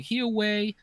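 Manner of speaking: a hint of a little kid's voice; a touch of a small child; Tristan's little voice. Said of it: "with his little voice".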